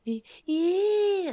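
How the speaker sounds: high, loud bratty whine; bratty kid whine. Retold or imitated. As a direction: imitate a brat's whiny protest. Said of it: "doing the fucking little brat thing".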